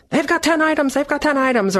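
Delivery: high-pitched mock outrage; indignant shopper. Shopper in the grocery store line, mock indignant. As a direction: complain like a fussy shopper.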